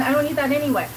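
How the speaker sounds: ordinary delivery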